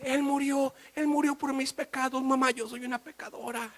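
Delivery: put-on a girl weeping over her sins; crying little girl. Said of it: "mi hija se puso a llorar".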